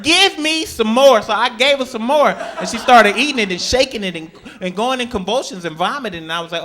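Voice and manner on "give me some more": slow, higher begging voice; mock dog plea, drawn out. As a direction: ham up the dog asking for more. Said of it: "I heard".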